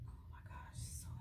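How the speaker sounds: a frustrated whisper to herself; exasperated whisper. Real, not acted. Fed-up vlogger pinning her hair, whispering to herself. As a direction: breathe it out with no voice.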